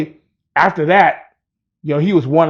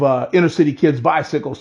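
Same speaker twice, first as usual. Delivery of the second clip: ordinary delivery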